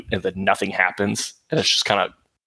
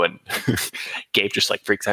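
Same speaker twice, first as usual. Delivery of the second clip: usual speaking voice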